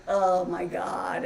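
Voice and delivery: slow, drawn-out exclamation of shock; stunned realization. Retold or imitated. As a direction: let the mistake dawn on you. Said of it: "And I was like".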